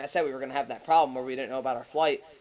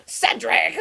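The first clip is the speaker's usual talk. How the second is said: higher, louder mock scolding call; upset mom; 6 semitones higher, 5 dB louder than usual. Upset mom calling her kid on the phone, mock scolding. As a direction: call out the name like an annoyed mother. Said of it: "she's getting fucking upset with me".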